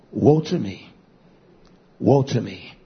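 low, grave woe to me from the prophet; mournful lament. Retold or imitated. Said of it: "But he says".

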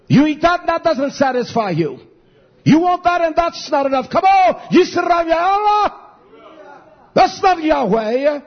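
preacher calling his people to wake up; preaching shout. Real, not acted. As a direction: lift it into a rallying shout.

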